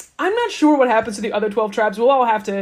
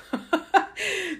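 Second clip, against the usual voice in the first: soft breathy chuckle, higher; real laugh; 8 semitones higher, 5 dB quieter than usual. Real, not acted. Host laughing at Aaron's passive-voice excuse, amused chuckle. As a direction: laugh quietly at the joke.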